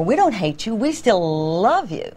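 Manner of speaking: "love" opening on a long held l, set apart by a pause; stress on LOVE. Shown as a demonstration. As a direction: linger on the l of "love". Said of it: "lengthen first the consonant in front".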